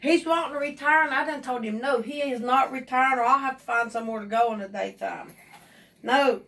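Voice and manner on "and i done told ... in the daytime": ordinary talk, nothing marked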